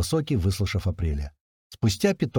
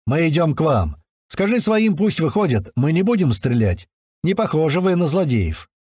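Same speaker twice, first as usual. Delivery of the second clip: loud call across the yard; shouting; 6 semitones higher, 4 dB louder than usual. A narrator's reading. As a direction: shout it over to them. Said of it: "Высокий крикнул".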